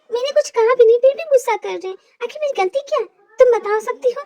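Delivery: two friends chatting about the moody brother; young woman's voice. Acted. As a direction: play the girlfriend confiding to her friend. Male actor voices two women.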